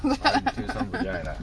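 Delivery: high, slow laughing delivery. Real, not acted. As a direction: giggle it out slowly. Laughing joke.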